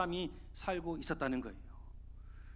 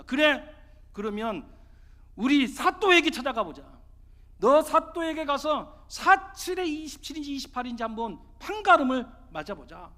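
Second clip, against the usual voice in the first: loud, raised-pitch furious challenge; angry outcry; 5 semitones higher, 10 dB louder than usual. Acted. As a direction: throw down the challenge at full voice. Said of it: "너무너무 화가 나서". (화가 나서 크게 외치기)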